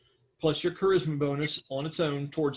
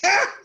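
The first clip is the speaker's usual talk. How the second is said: gruff dwarf laugh; 8 dB louder than usual. Acted. Dwarf slams gun down, laughs.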